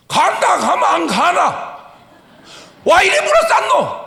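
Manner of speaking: loud, high, annoyed Gyeongsang dialect yell; gruff Gyeongsang shout. Retold or imitated. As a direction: snap back like an irritated Gyeongsang man. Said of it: "그게 경상도예요". (짜증 섞어 크게 외치는 경상도 사투리)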